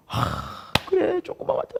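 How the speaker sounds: acted lament, sighing then high-pitched; hushed, pained sigh swelling into a high mock wail; grieving sympathy. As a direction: lament along with the mourners, voice rising high. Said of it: "막 같이 슬픈 거예요".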